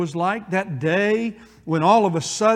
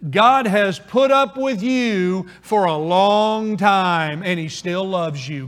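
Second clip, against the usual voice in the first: model phrase for the congregation; slower than usual. Shown as a demonstration.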